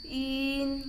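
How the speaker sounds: "in" with rounded lips, as ün; long "in" between i and u; fish-eye ün. Shown as a demonstration. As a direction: pout the vowel of "in" and hold it before the n. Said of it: "ini juga U mata ikan dan N".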